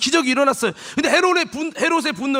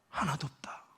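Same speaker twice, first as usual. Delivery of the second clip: hushed, much softer whisper; whispered punchline of the sermon; 7 semitones lower, 14 dB quieter than usual. Real, not acted. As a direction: drop to a whisper for effect. Whispered conclusion.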